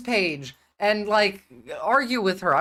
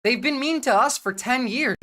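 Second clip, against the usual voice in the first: ordinary talk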